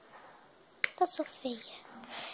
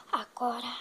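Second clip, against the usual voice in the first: whisper; 4 semitones lower, 6 dB quieter than usual. Acted. Leader's secret plan.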